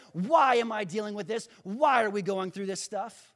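loud, insistent string of challenges; Gideon's doubting protest. Acted. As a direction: challenge God, hammering each why. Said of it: "that shows you Gideon's frame of mind".

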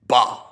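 loud scornful bah; gruff scoff. Acted. Scrooge snaps at nephew.

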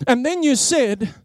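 ordinary talk

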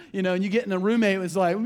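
usual speaking voice

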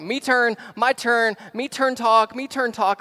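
put-on high, loud little-kid chant; a toddler insisting it's her turn; imitating a two-year-old who wants to talk; toddler demanding a turn. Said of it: "she's like".

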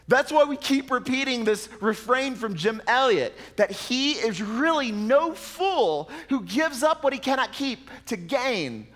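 emphatic pulpit quote; fervent preaching. Acted. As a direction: proclaim the refrain to the room. Preacher quoting a refrain.

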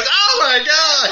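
high, loud, drawn-out cry; mock outraged shriek; horrified passerby. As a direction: yell like a stranger hit from above, laughing. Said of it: "Some guy on the street goes".

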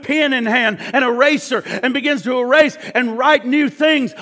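high, loud, driving preacher voice; fiery preaching. Acted. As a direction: preach it full force from the pulpit. Preaching at full force.

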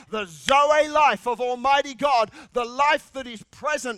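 loud, rising sermon drive; preaching at full force. Acted. Preacher declaring abundant life over his listeners. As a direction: press the promise home.

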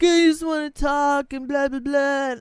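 a hint of a drunk intruder begging to talk; drunk girl voice. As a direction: lightly play the pushy drunk girl. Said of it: "she's like".

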